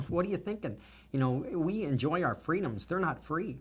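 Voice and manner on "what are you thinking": high, choked repeat of the question; getting emotional. Real, not acted. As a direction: still moved, pushing the words out. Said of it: "I'm sorry to get emotional".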